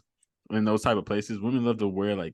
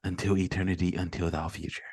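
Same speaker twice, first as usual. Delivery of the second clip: lightly put-on solemn poet, trailing lower; a hint of archaic suitor; mock poetic voice; 3 semitones lower than usual. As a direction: recite a mock love vow, haltingly. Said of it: "why do you have that voice?".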